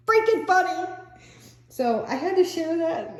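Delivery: high-pitched, laugh-filled; struggling through giggles; genuinely cracking up. Real, not acted. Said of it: "so I am laughing".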